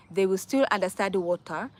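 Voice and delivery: ordinary talk, nothing marked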